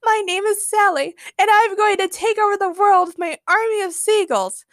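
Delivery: high-pitched madwoman voice; shrieky, theatrical villain lady; strange villainess. A narrator's reading. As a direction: proclaim world conquest in a high female voice. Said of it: "cackled a strange lady".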